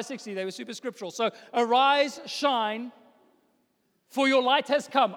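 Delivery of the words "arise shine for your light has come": loud, higher wake-up call; rousing call. Acted. Parent waking teenagers in the morning, loud and bright call. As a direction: rouse them like a parent opening curtains.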